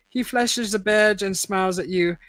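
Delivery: plain reading voice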